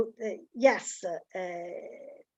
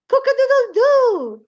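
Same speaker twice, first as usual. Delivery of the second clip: high, loud crow; rooster crow; 10 semitones higher, 9 dB louder than usual. Shown as a demonstration. Child in the guessing game crowing loud. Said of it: "try to guess what kind of bird".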